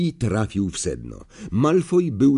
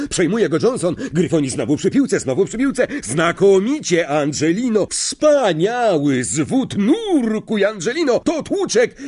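rising, much higher excited play-by-play; excited live commentary; 6 semitones higher than usual. Acted. Lee to the crowd, excited commentary. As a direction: shout the goal to the stands.

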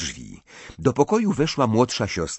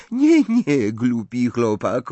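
put-on high, louder, French-accented dismissal; trilling amusement; 4 semitones higher, 6 dB louder, slower than usual. A narrator's reading. Fleur teases Harry for his silly question, amused. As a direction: brush it off gaily in a foreign lilt. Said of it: "wybuchając perlistym śmiechem".

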